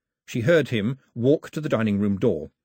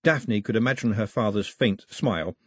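plain reading voice

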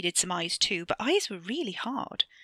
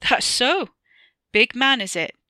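louder, higher mockery; mocking laugh; 5 semitones higher, 8 dB louder than usual. A narrator's reading. Stranger laughing at the boy's bravado, mocking. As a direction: needle the boy, amused. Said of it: "The man laughed".

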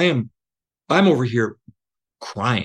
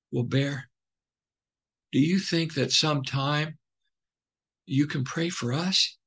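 put-on much softer, hesitant little-boy request; shy kids asking; 4 semitones higher, 8 dB quieter than usual. Campers shyly asking their counselor to pray for them, soft and timid. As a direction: ask it shyly, barely above a whisper. Boys shyly ask for prayer.

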